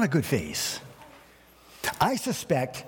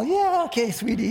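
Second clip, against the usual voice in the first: forced sweet reply; 9 semitones higher than usual. Retold or imitated. Pastor answering his wife sweetly while fuming inside. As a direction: smile through gritted teeth. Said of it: "I smile".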